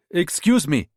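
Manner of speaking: polite stranger with a Ghanaian lilt; Ghanaian accent. Acted. Rita in disguise stopping the young mother, Ghanaian accent. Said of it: "staying with the Ghanaian accent".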